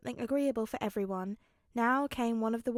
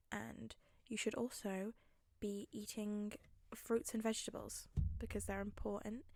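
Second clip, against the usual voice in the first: whisper; 9 dB quieter than usual. Real, not acted. Whispered self-care advice.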